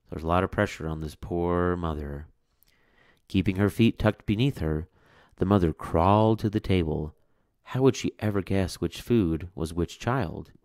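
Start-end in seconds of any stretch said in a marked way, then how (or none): none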